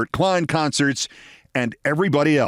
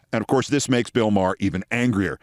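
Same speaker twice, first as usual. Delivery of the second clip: ordinary talk, nothing marked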